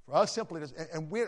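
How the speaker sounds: usual speaking voice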